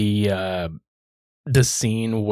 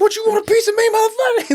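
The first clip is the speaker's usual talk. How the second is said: high, loud, challenging yell; mock angry yell; 4 dB louder than usual. Boyfriend yelling at the heckler, as a high, loud mock challenge. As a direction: pick a fight across the crowd. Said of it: "he's just yelling at him".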